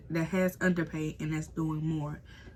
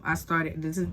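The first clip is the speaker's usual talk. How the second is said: normal talking voice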